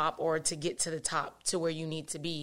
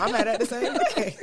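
higher-pitched, laughing retort; laughing mock commiseration; 6 semitones higher, 4 dB louder than usual. Mother answers crying kids, laughing mock commiseration. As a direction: join the complaint through laughter.

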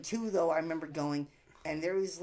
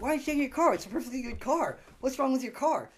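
mock innocent chase; 7 semitones higher, 6 dB louder than usual. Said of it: "chasing after me, like".

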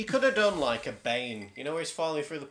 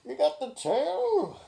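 high, slow Goofy-like Quint voice; New England accent; 13 semitones higher, slower than usual. Retold or imitated. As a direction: drawl it out as a cartoonish Quint. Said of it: "tried to still do the New England accent".

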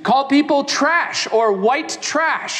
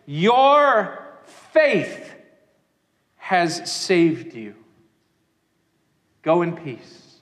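tender blessing; 5 semitones lower, much slower than usual. Acted. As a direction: send her off gently in peace. Jesus speaks gently to woman.

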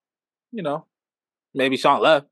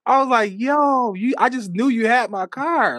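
incredulous; 7 semitones higher than usual. Retold or imitated. Retold disbelief.

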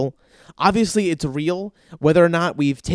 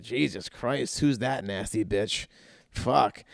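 much softer, slow muttered remark; muttered aside; 5 semitones lower, 8 dB quieter, slower than usual. Retold or imitated. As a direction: say it under your breath. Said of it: "And I'm like".

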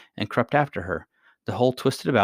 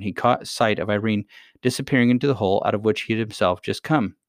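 usual speaking voice

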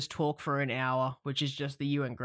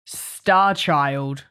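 excited; 4 semitones higher, 7 dB louder, slower than usual. Acted. Hosts' playful intro wordplay.

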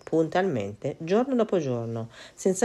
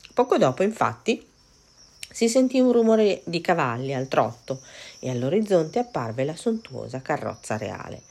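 usual speaking voice

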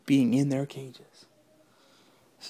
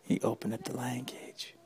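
hushed whisper; suspense whisper; 12 dB quieter than usual. A narrator's reading. Storyteller whispers as the lion gets free.

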